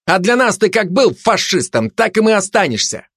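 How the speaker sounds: high-pitched testy retort; mildly irritated comeback; snapping back. A narrator's reading. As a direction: dismiss him irritably. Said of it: "огрызнулся турист".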